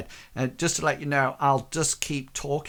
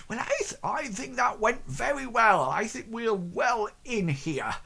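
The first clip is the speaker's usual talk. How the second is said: high, self-satisfied aside; smug baker voice to his wife; pleased baker; 9 semitones higher than usual. Acted.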